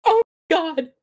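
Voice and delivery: high, loud horrified cry; acted scream. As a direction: scream it out in shock, high-pitched. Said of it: "she screams. She's like".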